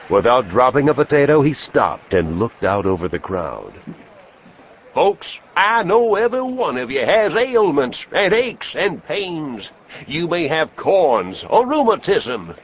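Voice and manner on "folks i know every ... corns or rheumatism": high, booming medicine show pitch; snake-oil salesman. Acted. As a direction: sell it hard to the crowd like a huckster. Traveling professor sells his elixir.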